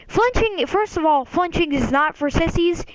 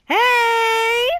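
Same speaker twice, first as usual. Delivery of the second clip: high, long, sustained imitated call; annoying kid; 8 semitones higher, 3 dB louder than usual. Kid coming back to bother him, hammed-up pestering call. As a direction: stretch it out high like a pest.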